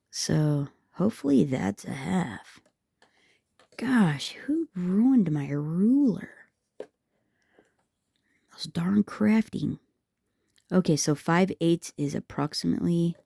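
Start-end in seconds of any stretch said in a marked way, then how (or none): 0.1-4.3 slow whispered hope. Real, not acted. Crafter struggling with a worn ruler, whispering. Squinting at hard-to-read ruler. 4.5-9.8 slow, whispered mock complaint; whispered grumble. Real, not acted. Crafter blaming her crafting tools, whispering slowly.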